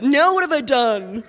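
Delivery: whiny sibling. Retold or imitated. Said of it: "I have said".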